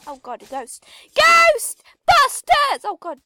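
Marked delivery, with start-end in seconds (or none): 1.2-2.8 high, loud, drawn-out call; playful shout. Pretend. Player spotting a ghost in the game, shouting. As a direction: shout it out in two long bursts.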